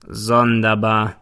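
slow, smug grinning drawl; amused prosecutor, slow and sly. A narrator's reading. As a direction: savour the word with a smirk. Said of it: "grinst der Staatsanwalt".

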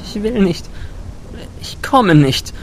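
high, defiant refusal hiding fear; defiant but scared. A narrator's reading. Girl refusing the thunder lord, high defiant tone. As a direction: refuse him bravely while afraid. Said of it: "mit trotzig klingendem Unterton".

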